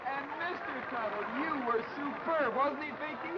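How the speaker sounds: big, fast game show praise; booming prize announcer. Acted. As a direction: pump up the contestants on air. Host praising contestants on air.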